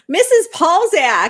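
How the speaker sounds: loud, drawn-out greeting; calling out. Real, not acted. Greeting the arriving teacher with a loud call. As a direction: call her name across the room.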